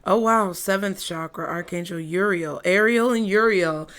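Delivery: ordinary delivery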